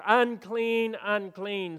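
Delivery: lepers' shouted warning. Acted. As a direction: call out to warn people away. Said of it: "they had to shout out".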